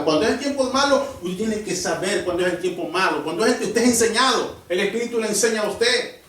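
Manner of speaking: loud, driving pulpit preaching; preaching at full force. Acted. Preacher warning the congregation about trials, loud full-force preaching. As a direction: hammer the warning home to the church.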